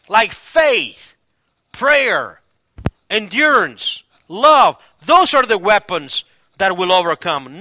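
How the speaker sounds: loud, high, emphatic sermon delivery; forceful preaching. Real, not acted. Pastor urging the church to fight with faith, forceful preaching. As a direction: preach it hard, landing on each weapon.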